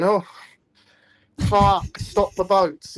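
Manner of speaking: high, loud, slow mock complaint; mocking whiny rant. Mocking critics who only care about the boats, in a high, loud, slow mock whine. Mocking anti-immigration people.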